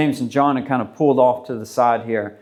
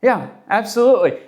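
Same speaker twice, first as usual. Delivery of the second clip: eager disciples; 5 semitones higher than usual. Retold or imitated. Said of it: "the disciples would have said".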